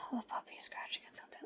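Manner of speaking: fast whispered aside. Real, not acted. Owner whispering fast about the scratching puppy. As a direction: hurry it out in a whisper.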